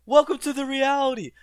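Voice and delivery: high, loud sarcastic jab; mocking the grieving kid with a loud high sneer; mock taunt. Mock-harsh taunt at David.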